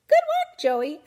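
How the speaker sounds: very high, loud hamster squeak; squeaky hamster. A narrator's reading. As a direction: cheer him on with a squeak. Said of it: "I squeaked".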